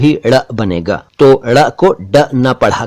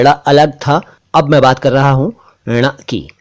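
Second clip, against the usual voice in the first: normal talking voice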